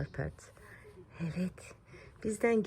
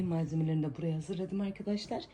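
usual speaking voice

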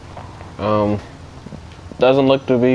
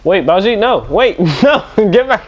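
high-pitched panicked calls, climbing higher; frantic calling out; 8 semitones higher, slower than usual. Acted. Driver calls runaway Baljeet back, frantic calling. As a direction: call him back as your voice climbs. Calling after fleeing companion.

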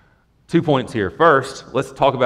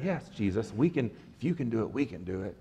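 much softer, quick smug whisper of yes; mock arrogance; 13 dB quieter than usual. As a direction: mumble it like a cocky boast under your breath. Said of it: "They're so arrogant".

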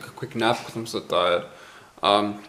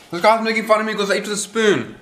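mock indignant; 8 semitones higher, faster than usual. Real, not acted. Mock outrage about the spoon.

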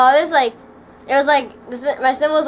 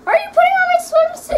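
high, fast shouted question; shouting; 7 dB louder, much faster than usual. Real, not acted. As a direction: fire off an outraged question. Shouting at brother in swimsuit.

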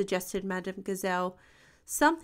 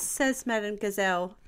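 usual speaking voice

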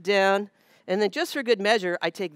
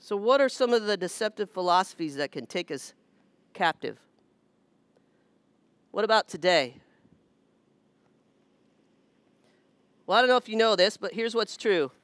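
raised, emphatic sermon voice; preaching; 4 semitones higher than usual. Acted. Pastor warns the congregation about false teaching, raised and emphatic. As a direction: drive the lesson home from the pulpit.

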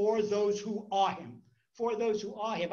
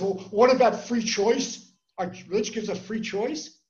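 know-it-all; 2 semitones higher, 9 dB louder than usual. Retold or imitated. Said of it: "he wanted to show he's well-educated".

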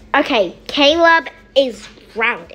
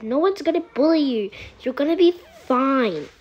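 ordinary talk

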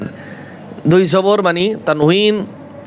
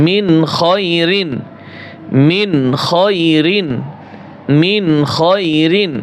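slow joined reading; much slower than usual. Shown as a demonstration. Teacher slowly blends syllables for students. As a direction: slowly join মিন খয়রিন with a clear নুন. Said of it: "slow joined reading demo".

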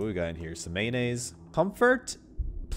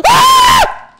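scream; 18 dB louder than usual. Real, not acted. Jump scare scream.

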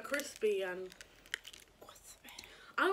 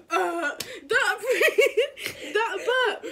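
laughing; 7 semitones higher, 10 dB louder, much slower than usual. Real, not acted. Laughing fit retelling.

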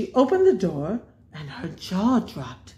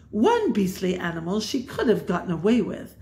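usual speaking voice

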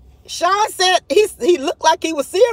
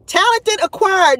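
normal talking voice

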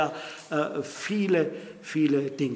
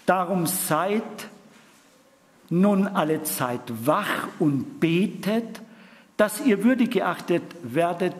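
louder, measured proclamation; solemn scripture reading; 2 semitones higher, 4 dB louder than usual. Acted. Preacher reading Luke 21 to congregation, solemn and loud. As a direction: declare it like a warning. Reads Jesus' words.

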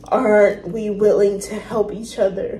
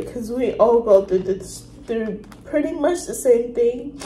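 usual speaking voice

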